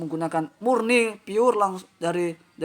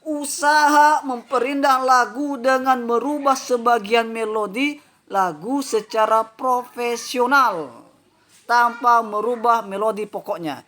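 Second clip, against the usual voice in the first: reading a definition aloud; 5 semitones higher, 4 dB louder than usual. Shown as a demonstration. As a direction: read the definition out, higher and louder. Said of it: "Saya bacakan". (membacakan definisi dengan lantang)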